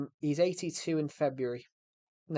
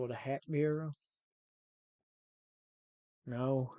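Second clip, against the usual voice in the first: lightly put-on American movie accent; attempted American accent. Streamer recreating the Taxi Driver interview, attempted American accent. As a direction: try on an American accent for a film scene. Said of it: "Good accent?".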